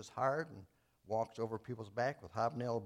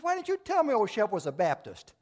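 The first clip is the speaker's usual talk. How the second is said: eager pastor punchline; 8 semitones higher, 9 dB louder than usual. Retold or imitated.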